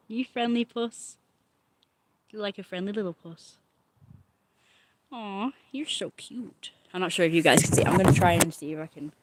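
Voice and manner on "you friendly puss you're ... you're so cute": soft cat cooing. Real, not acted. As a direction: coo quietly at the kitty.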